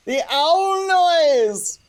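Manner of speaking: high and very slow, stretched out; thrilled real announcement; excited. Real, not acted. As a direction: savour every word with glee. Announcing the owl noise.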